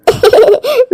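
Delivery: a high, pleased laugh in a different voice. Acted.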